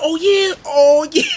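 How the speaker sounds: high, loud taunting shout; loud challenge. Retold or imitated. Narrator squaring up to a crowd, loud high taunt.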